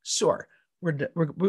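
normal talking voice

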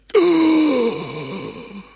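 loud theatrical gasp of horror; hammed-up shocked intake of breath; mock horrified gasp.

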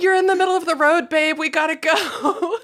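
high, quick mock urging; urging strangers. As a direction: hurry her off the road. Strangers urging her off road.

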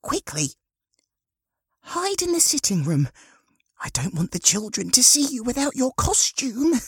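very high, urgent woman's voice; fussing grey-haired woman. Acted. Woman hiding Harry from the children, high and urgent. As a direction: hurry him out of sight in high tones.

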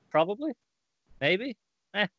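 high, offhand shrug of a reply; shrugging reply. Retold or imitated. Bunker brushing off Jason's question, offhand and high. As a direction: toss it off like you barely care. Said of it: "And he's like".

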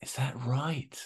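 whispered, high, teasing doubt. Real, not acted. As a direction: whisper it like a sly tease.

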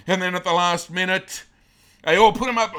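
preacher shouting out the battle's turn; rising excitement. A narrator's reading. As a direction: let the story explode. Climax of battle story.

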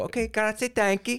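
high, put-on Italian lilt; mock Italian goodbye. Leaving a garage sale in a high fake Italian accent. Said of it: "I go".

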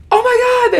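high-pitched mock squeal of shock; hammed-up high, excited exclamation; losing their minds. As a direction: imitate friends freaking out, pitch way up. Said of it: "They, like, lose their minds".

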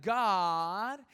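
very slow, stretched-out mother teaching a verse; wife slowly teaching a four-year-old a Bible verse; drawn-out teaching. Retold or imitated. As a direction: spell it out slowly for a toddler. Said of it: "she's doing, like".

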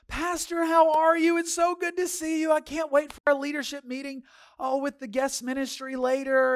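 mock-gushing, high loud singsong; gushing greeting. Imitating couple's gushing greeting to pastor.

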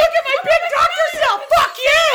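loud, jeering shout; angry mockery. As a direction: jeer at full volume over the host. Elf mocks rival, shouting.

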